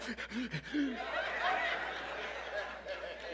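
quiet airy chuckle at the memory; breathy unvoiced laugh. Real, not acted. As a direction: let a soft laugh slip out.

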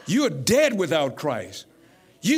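normal talking voice

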